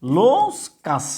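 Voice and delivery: লোকাস said slowly, each sound drawn out; slow model pronunciation. Shown as a demonstration. Teacher to students, slow clear pronunciation. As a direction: drill লোকাস slowly for the class. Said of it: "এটা হচ্ছে".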